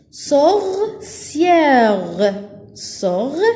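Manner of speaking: "Sorcière" split sor, ciè, re; syllable by syllable. Shown as a demonstration. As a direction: stretch each syllable of "Sorcière" and pause after it. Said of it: "on a trois syllabes ici". (« Sorcière » découpé en trois syllabes)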